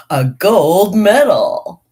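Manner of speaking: loud, drawn-out boast; proud proclamation. Real, not acted. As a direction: announce the prize with a big flourish. Boasts of her winning poem.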